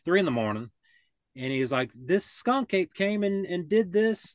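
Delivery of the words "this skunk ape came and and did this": mildly upset, raised pitch, slower. Retold or imitated. Homeowner at three in the morning showing damage, upset. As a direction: imitate an upset homeowner's complaint. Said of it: "he was really upset".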